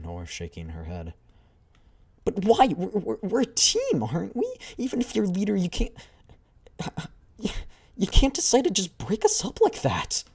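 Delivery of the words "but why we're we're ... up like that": put-on distraught younger girl. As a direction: beg her not to split the team. Upset girl pleads against breakup.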